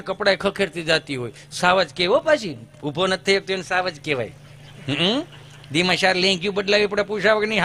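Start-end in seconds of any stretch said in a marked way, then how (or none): none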